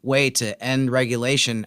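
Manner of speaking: normal talking voice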